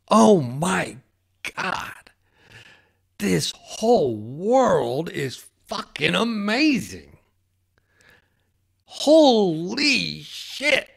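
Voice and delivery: high, slow, drawn-out cry of wonder; overwhelmed awe. Retold or imitated. Man overwhelmed by this amazing world, crying out in awe. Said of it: "And I'm just like".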